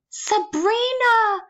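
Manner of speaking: high, slow, delighted calling of names; excited cry. A narrator's reading. Grandmother greeting her granddaughters, thrilled high cry. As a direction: call out to the girls with joy, up high. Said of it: "the woman cried with a hint of a German accent".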